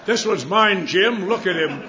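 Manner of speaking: loud, high, laughing delight; gleeful. Retold or imitated. AA member gleefully claiming the newcomer, loud and laughing. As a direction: grab the new drunk like a prize.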